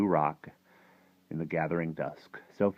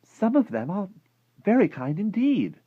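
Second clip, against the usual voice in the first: high, loud, emphatic defence; indignant girlish insistence; angry cry; 8 dB louder than usual. A narrator's reading. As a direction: argue back hotly in a girl's voice. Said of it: "Sophie cried angrily".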